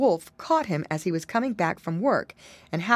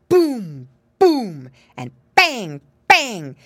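loud play-gun booms; calling out; 2 semitones higher, 8 dB louder, much slower than usual. Acted. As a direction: shout the bangs like a child at war. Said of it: "the boy animals called out".

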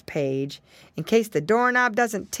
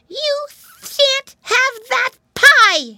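very high, loud, slow piglet cry; brave shout; 10 dB louder, much slower than usual. A narrator's reading. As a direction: shout bravely like a small pig. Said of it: "he cried".

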